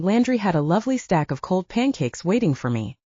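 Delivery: usual speaking voice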